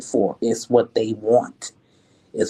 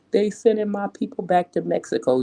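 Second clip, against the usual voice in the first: mocking whine; 9 semitones higher, faster than usual. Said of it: "she was actually crying and crying and saying".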